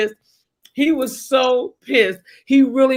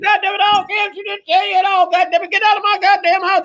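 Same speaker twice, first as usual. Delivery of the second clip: mock furious dad; 7 semitones higher, faster than usual. Dad ordering the sergeant out, fast mock rage. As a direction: rush to throw him out of the house.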